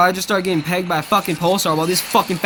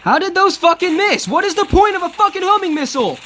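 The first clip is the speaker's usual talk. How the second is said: much higher and louder, incredulous raging; furious shout; 12 semitones higher, 4 dB louder than usual. Real, not acted. Gamer raging at the game after homing missiles miss, furious shout. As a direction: yell in disbelief at the missed missiles.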